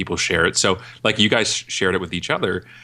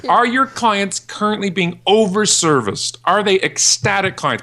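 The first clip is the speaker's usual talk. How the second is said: much higher, slow, incredulous questioning; mock incredulous; 12 semitones higher, 3 dB louder, slower than usual. Speaker challenging the clients, slow mock incredulity. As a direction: spell it out like talking to someone clueless. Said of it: "I'm like".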